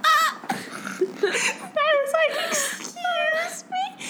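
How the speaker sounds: high squeaky laughing Excuse me, slow; laughing disbelief. Retold or imitated. Hearing mom's correction, laughing disbelief high. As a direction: choke out excuse me through laughter.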